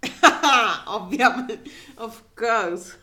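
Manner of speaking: laughing loudly through the words; real giggle over a misread word; laughing at a slip. Real, not acted. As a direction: crack up mid-correction. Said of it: "I was saying ovviamente".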